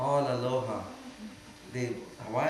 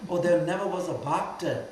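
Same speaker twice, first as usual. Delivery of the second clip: raised, louder mock adoration; mock devotion; 5 semitones higher, 5 dB louder than usual. Master mocking those who cry for devotion, hammed-up admiration. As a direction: gush like a fake devotee. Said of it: "they're crying a devotion".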